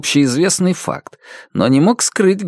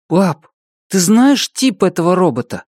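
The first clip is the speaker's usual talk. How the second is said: put-on child voice; 7 semitones higher than usual. Narrator voices seven-year-old boy.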